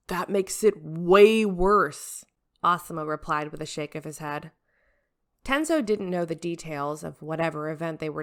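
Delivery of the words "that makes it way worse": very slow, louder put-down; dry retort. Acted. Asuma shaking his head at the captain, slow dry put-down. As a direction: drag it out, unimpressed.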